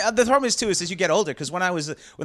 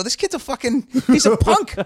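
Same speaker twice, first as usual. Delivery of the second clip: scoffing; 11 semitones higher, 5 dB louder than usual. Retold or imitated. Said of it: "Now I look at him and go".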